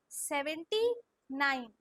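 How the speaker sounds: Seventy drawn out, then falling nine; very slow dictation. Shown as a demonstration. Teacher tells pupils to say it slowly, drawn-out model. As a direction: stretch seventy, pause before nine. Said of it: "slow slow बोलो".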